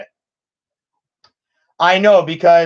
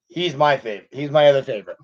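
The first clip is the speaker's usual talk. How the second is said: normal talking voice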